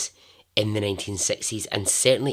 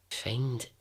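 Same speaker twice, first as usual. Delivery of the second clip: ordinary delivery, nothing marked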